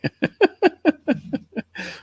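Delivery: high-pitched run of chuckles; real tickled laughter; amused laugh. Real, not acted. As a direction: break into a long, high giggle. Amused by viewer's chat comment.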